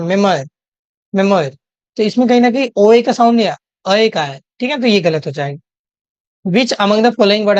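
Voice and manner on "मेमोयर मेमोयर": मेमोयर said as mem-war, with no oi sound; demo pronunciation. Shown as a demonstration. Teacher shows students how memoir is pronounced. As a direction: say मेमोयर with a short a-e ending. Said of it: "इसका pronunciation ऐसा होता है".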